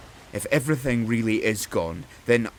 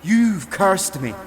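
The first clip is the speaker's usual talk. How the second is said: slow, higher, louder accusation; anguished outburst; 7 semitones higher, 6 dB louder, much slower than usual. Acted. The king realises he is cursed, slow anguished shout. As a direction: drag out the words in horror.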